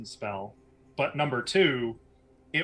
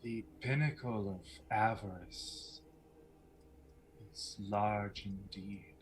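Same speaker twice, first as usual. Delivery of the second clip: slow, hushed, measured menace; softly spoken mysterious woman; mysterious villainess; 5 semitones lower, 12 dB quieter than usual. Acted. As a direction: speak softly and slowly, like a sly enchantress. Game master voices Lucretia.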